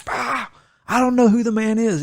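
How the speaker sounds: high, mock-angry outburst; hammed-up exasperated denial; retold cursing denial. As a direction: deny it hotly with pitch jumping up. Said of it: "he called down curses".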